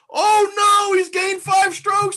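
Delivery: very high, loud, wailing mock alarm; mock panic. Host ridicules a stat favouring the player, mock panic. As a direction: shriek it in fake dismay.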